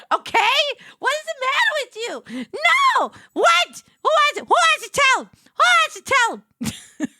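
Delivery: very high and loud, near shrieking in mock outrage; outraged yell. Real, not acted. Streamer demanding who will tell chat her accent is real, yelling. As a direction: yell it, fully scandalised.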